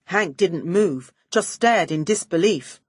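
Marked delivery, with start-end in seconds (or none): none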